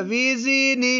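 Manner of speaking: high, drawn-out syllables. Shown as a demonstration. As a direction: stretch it out high for the pupil. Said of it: "ऊंचा".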